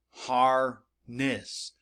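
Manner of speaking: harness slowly as HAR... NESS; broken into syllables. Shown as a demonstration. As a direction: leave a gap in the middle of harness. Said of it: "break it up in the syllable break".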